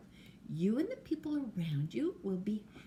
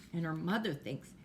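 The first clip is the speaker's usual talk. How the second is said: plain reading voice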